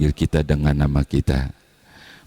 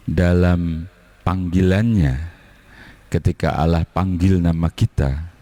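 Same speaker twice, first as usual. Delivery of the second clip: normal talking voice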